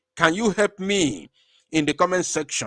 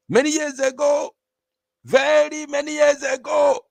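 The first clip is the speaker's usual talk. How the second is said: excited shout; 10 semitones higher, 4 dB louder than usual. Real, not acted. Preaching rise about Biafra history.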